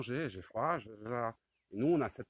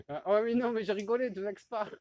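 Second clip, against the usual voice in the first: high appeasing exclamation; 8 semitones higher, 8 dB louder than usual. Real, not acted. Appeasing monkey after teasing.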